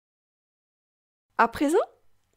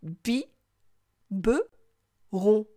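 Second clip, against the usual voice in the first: "Bi, be, ron" pulled apart with long pauses; syllable by syllable; much slower than usual. Shown as a demonstration. Teacher asks child to listen, syllable by syllable. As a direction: say "Bi", "be", "ron" as three separate beats. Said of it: "Écoute".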